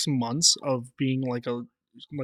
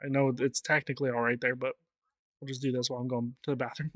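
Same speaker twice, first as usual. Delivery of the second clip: ordinary talk, nothing marked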